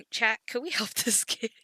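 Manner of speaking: can't keep a straight face; laughing through it. Acted. Streamer laughing as she re-asks the chat.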